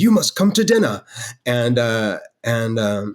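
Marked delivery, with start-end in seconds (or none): 0.0-1.0 much higher, louder self-mocking British accent; bad British accent. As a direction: ham up a British accent, pitched high. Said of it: "pardon my horrible British accent".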